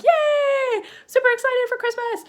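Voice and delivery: loud, very high-pitched cheer; super excited. Shown as a demonstration. Teacher acting excited for Christmas, excited cheer. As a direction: squeal it like a thrilled kid at a holiday. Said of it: "when I'm really excited".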